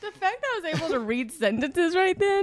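talking through burning lips; amazed, mouth still on fire; mouth burning from spicy food. Real, not acted. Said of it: "I can't feel my lips".

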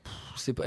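unvoiced scornful pff; scornful sigh. Real, not acted. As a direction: sigh it out, dismissive. Dismissive about the character.

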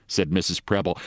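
ordinary delivery

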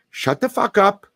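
high, hard snap of a command; sharp command. Pretend. Trainer stops a screaming dog in its crate, high sharp command. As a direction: bark it at the dog to stop screaming.

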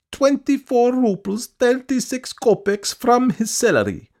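very high, loud complaining woman; piping, aggrieved supplicant; insistent petitioner. A narrator's reading. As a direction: pour out a grievance in a high-pitched voice. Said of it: "speaking rapidly".

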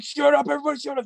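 high yelled shut up; shouting. Retold or imitated. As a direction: bark it at the room. Retold yelling at friends.

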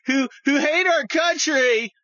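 high, loud mock gushing lib; hammed-up excited liberal shout. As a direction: squeal it like a smug liberal fan. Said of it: "all these libs are like".

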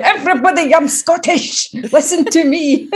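put-on loud proud Scottish accent, joking; exaggerated Scottish accent. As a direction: show off a broad Scottish accent, laughing. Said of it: "my Scottish accent gets way stronger".

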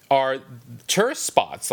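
usual speaking voice

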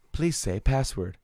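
slow automated prompt; measured mock machine announcement; computer voice. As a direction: imitate a lab computer requesting input. Voicing the laboratory's computer.

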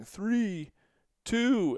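high-pitched mock countdown call. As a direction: call it out like a ride technician. Technician calling out the countdown.

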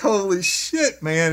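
high, loud, drawn-out disbelief; retold horror, slowly stretched and loud; shocked exclamation. Retold shock, nearly threw up.

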